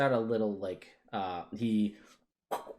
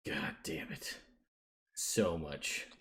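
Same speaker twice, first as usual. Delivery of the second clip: muttered curse; 5 semitones lower, 8 dB quieter, slower than usual. Acted. NPC reacts to prophecy question.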